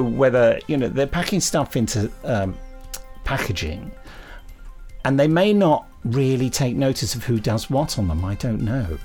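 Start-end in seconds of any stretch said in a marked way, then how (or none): none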